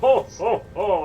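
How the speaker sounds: loud, jolly-style Santa ho-ho-ho; Santa Claus. Pretend. Game master playing God to a player, mock Santa. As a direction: belt out a mock Santa laugh. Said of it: "God is Santa?".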